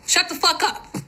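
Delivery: high angry dismissal; angry retort. Real, not acted. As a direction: cut them off, high and sharp. Telling off critics.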